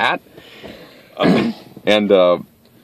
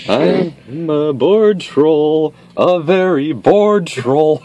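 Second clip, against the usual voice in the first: bored troll voice; 7 semitones higher, slower than usual. Pretend. Jokey troll role play.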